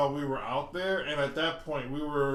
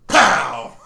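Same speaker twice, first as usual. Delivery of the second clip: explosive imitated slap sound; loud "pow" impact; 15 dB louder than usual. Acting out a movie slap for friends, loud pow. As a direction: fire off one very loud smack noise.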